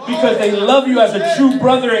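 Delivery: loud, driving sermon delivery; impassioned church exhortation, projected loud; full-force preaching. Real, not acted. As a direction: preach it hard, punching each phrase loudly.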